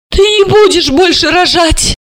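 high, indignant cry; outraged order. A narrator's reading.

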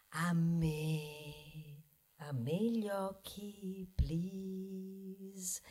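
voce da ipnotizzatore più bassa e lenta; ipnotizzatore beffardo. Retold or imitated. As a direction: incanta il pubblico con finta suadenza. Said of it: "i pochi fanno". (parodia lenta e grave di un incantatore)

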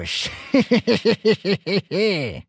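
high, long tittering laugh; gleeful mocking snicker, falling at the end; drawn-out he-he-he; teasing giggle. Master laughs, teasing the elf.

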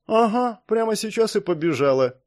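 sulky, mocking retort; disgruntled grumble. A narrator's reading. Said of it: "недовольно пробурчала Марис".

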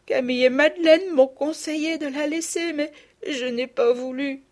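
voix aiguë de petite fille, en pleurs; elle avoue sa faute en pleurant; enfant en larmes. Acted. (aveu d'enfant aigu et en pleurs)

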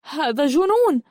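high, rushed refusal; indignant protest. Acted. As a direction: protest hotly, pitch climbing. Girl protests she is no warrior. (احتجاج غاضب بنبرة متصاعدة)

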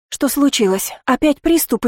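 high, scared cry; Lizzie, frightened for Aliana, exclaiming. A narrator's reading. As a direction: gasp it out in fright. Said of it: "испуганно воскликнула Лизи".

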